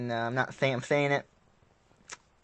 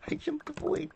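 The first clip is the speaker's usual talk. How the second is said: proud squeak; 5 dB quieter than usual. Real, not acted. Proud of the jump.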